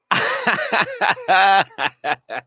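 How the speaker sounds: long, high, loud burst of laughter; hearty laugh. Real, not acted. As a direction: burst out laughing and let it run on.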